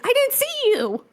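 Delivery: high, squeaky imitated excuse; defensive excuse. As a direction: play yourself caught out and embarrassed. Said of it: "I was like".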